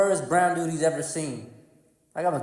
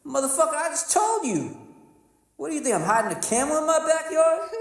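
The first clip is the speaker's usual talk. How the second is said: high-pitched indignant comeback; exasperated retort; 9 semitones higher than usual. Retold or imitated. Comic answering the stranger who doubts his hometown, exasperated.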